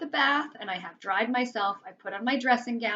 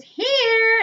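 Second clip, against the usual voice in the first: high, loud, drawn-out call; playful holler from an unseen child; 13 semitones higher, 10 dB louder than usual. A narrator's reading. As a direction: sing it out long and high. Invisible child calls out to mother.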